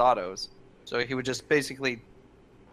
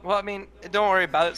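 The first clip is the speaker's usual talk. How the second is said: ordinary talk, nothing marked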